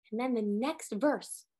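usual speaking voice

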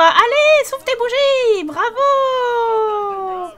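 high, loud cheer; cheering a birthday boy over his cake. Pretend. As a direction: egg him on to blow the candles. Cheering the Sim's candles.

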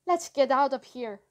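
plain reading voice

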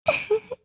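a light, amused little laugh; soft teasing giggle. Acted. To her commander, a soft teasing giggle. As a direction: giggle at him, charmed.

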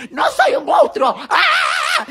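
loud high slow shout. Pretend. Kid chased off by strangers, loud high shout.

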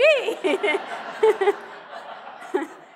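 a loud, high real laugh. Real, not acted. Rabbi laughs at Oprah objecting to turning off the TV, loud real laugh.

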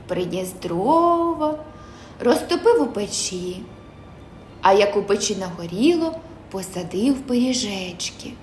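ordinary delivery, nothing marked

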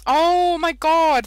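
high loud slow oh my god; sudden realisation; high loud slow shock at the prank. Real, not acted.

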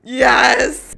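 loud victory yell; cheering. Real, not acted. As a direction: shout it in triumph. Cheers after winning.

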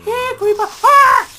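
high, loud yell at the creeper; panicked shout. Pretend. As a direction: shriek as it blows up. Calling the creeper, then panicking.

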